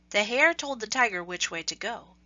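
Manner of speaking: plain reading voice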